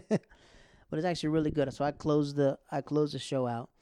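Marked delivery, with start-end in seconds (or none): none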